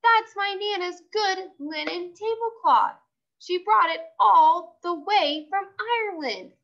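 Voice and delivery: put-on high, indignant girl's voice; upset Lucille. A narrator's reading. Lucille scolding her friend over the stained tablecloth.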